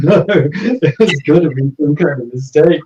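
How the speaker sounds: loud, incredulous objection through a laugh; laughing protest. Retold or imitated. Retold protest at being told he died.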